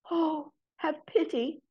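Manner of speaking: high, slow pleading whisper; a thin, begging wisp of a voice; whispery voice. Acted. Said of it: "she said in a whispery voice".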